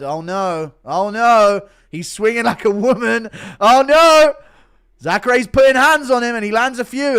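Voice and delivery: loud, high, excited play-by-play call; fight commentary. Acted. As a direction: open on a big drawn-out cry, then call the action like a commentator.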